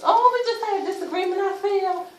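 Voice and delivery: high bright breezy cover-up; fake cheerful. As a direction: play it off lightly. Fake cheerful cover story to neighbors.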